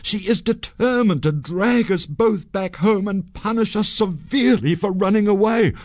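high, urgent breaking of bad news; dramatic put-on dread; feigned alarm. A narrator's reading. Sanjay feigns alarm about wife.

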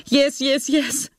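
high-pitched cry at no extra volume; an anguished confession in a high, contained scream; mock scream. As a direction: scream it without real loudness. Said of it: "she screamed".

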